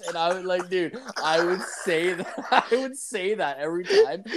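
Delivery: high, laughing through words; giggling while telling. Real, not acted. Laughing at his own joke.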